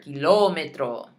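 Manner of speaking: "kilómetro" as "kee-LO-meh-tro", the LO punched; stress on LÓ. Shown as a demonstration. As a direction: hit the accented O in "kilómetro". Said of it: "Same thing here".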